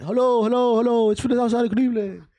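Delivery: high, loud mock phone call; hollering down string phone. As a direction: call out as if through a cup on a string. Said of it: "That's how they talk".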